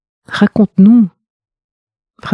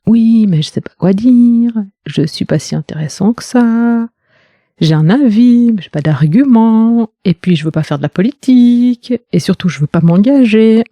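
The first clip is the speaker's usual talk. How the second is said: higher-pitched voice of self-doubt, listing excuses; hesitant inner ego; 4 semitones higher than usual. Acted. Listener's ego making excuses not to speak up, hesitant inner voice. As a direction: voice the reluctant inner voice, each excuse lifted. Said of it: "ton dialogue intérieur avec ton ego".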